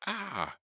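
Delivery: high disgusted yelp; disgusted cry. Acted. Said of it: "Kimmy let out a disgusted cry".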